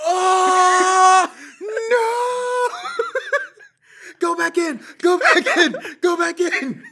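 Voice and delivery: very high, loud wailing shriek; hammed-up panicked scream. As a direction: scream it in comic horror, as if begging. Acting out horror at the punchline.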